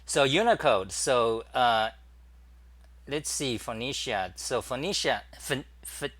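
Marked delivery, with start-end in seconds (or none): none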